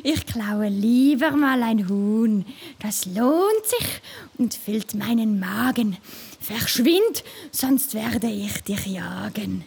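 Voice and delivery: a cunning fox warning off a pest; sly fox. A narrator's reading. Fox shoos squirrel away with a low threat.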